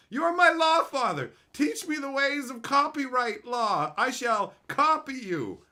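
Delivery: hammed-up devoted disciple; mock worshipful plea. Begging Papa French to adopt him, high reverent plea.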